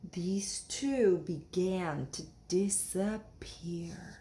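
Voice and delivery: slow, soft whisper; tense whispered narration; hushed. A narrator's reading. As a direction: hush it as the water rises.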